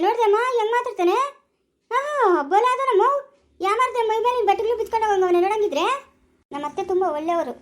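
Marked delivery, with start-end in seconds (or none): 0.0-5.8 high, loud angry ranting; mother-in-law ranting loudly about him. Acted. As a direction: shout your outrage out. Mother-in-law rants angrily.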